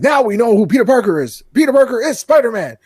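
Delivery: loud, high newscast rant; J. Jonah Jameson. Acted. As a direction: announce it like an outraged TV pundit. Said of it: "you hear J. Jonah Jameson talking".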